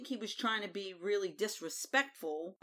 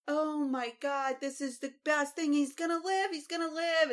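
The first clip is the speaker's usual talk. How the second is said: much higher, gushing joy; imitating overjoyed Jon; 7 semitones higher than usual. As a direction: squeal it like the best news ever. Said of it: "he's, like, so excited".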